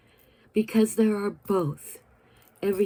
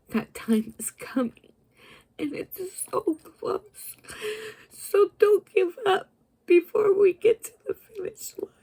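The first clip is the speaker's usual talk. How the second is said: tearful whisper; 12 semitones higher than usual. Real, not acted. Promising her sea stars, crying whisper. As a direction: plead through tears, don't give up. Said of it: "getting emotional".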